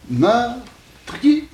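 "meurtrier" pitched high, split into meur and trier; two-syllable pronunciation. Shown as a demonstration. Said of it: "deux syllabes".

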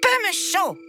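a bratty little prince listing his wishes; spoiled child prince. Acted. Spoiled little prince lists his wishes, high and whiny. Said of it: "répondit le petit prince".

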